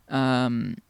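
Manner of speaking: normal talking voice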